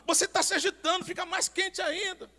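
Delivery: loud preaching. Retold or imitated. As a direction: call it out to the church. Retells preaching to the congregation.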